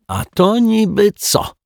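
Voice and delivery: very high, stretched question; challenging retort. A narrator's reading. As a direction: throw it back as a high, slow challenge. Accuser challenges the detective with evidence. (zaczepnie, wysokim głosem)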